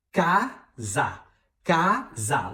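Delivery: "Casa" split syllable by syllable; two separate syllables. Shown as a demonstration. Teacher counting syllables for learners, "Casa" in two beats. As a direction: break "Casa" into ca, pause, sa. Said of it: "tem duas sílabas".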